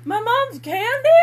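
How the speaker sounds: high incredulous question; surprised. Acted. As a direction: ask it in disbelief. Acting the character's surprise.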